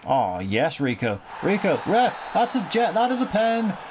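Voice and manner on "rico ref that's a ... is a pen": high, eager shout at the ref; excited appeal. Real, not acted. Fan appealing to the ref for a penalty, high excited shout.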